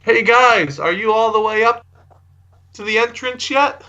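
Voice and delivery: high-pitched, half-held-back holler; mock yell, pitched well up; calling into cave. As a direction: call out to someone far inside a cave. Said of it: "yell out into the cave".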